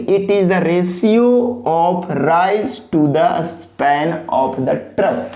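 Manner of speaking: very slow, every word drawn out for copying; dictation pace. Shown as a demonstration. Teacher dictating the definition for students to copy, very slowly. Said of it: "copy भी करेंगे pause करके".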